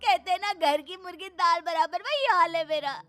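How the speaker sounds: high-pitched, hammed-up sobbing complaint; tearful wailing. Neglected wife to husband, feeling unloved, theatrical wailing. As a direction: wail it like a melodramatic wife.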